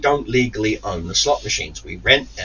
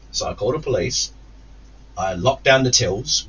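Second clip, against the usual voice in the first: normal talking voice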